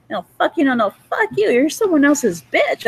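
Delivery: high-pitched mock exasperation; exasperated. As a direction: act out a fed-up retort, pitched high. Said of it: "I was exasperated".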